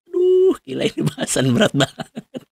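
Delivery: high, exasperated groan of "Aduh, gila"; real mock-overwhelmed exclamation. Real, not acted. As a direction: sigh out how heavy the topic is. Exclaims over heavy topic.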